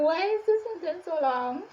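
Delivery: high, loud mock despair; exasperated outburst. Reader at a sentence that hasn't ended, exasperated. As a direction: cry out in comic frustration. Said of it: "I was like".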